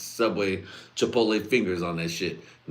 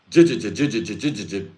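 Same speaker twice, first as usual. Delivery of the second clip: loud repeated stammering j-; stuttering j sound; 6 dB louder than usual. Retold or imitated. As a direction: stutter on j over and over, loud at first. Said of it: "he always goes".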